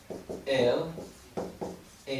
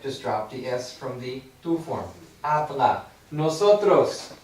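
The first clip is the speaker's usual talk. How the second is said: ordinary talk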